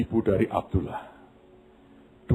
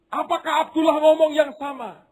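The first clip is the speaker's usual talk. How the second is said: eager question; 4 dB louder than usual. Acted. Family asks eagerly.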